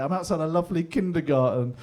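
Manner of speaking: ordinary talk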